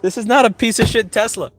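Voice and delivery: imitating friend yelling to stop slamming his car door; imitating Steve. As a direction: squawk like a friend guarding his baby. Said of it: "he's like".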